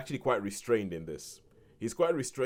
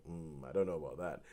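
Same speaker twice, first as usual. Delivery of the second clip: doubtful mutter; 5 semitones lower, 9 dB quieter than usual. Retold or imitated. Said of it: "I'm like".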